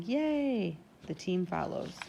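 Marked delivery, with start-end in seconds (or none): 0.0-0.8 high, stretched-out cheer; a long, high yay; narrated shout of joy. A narrator's reading.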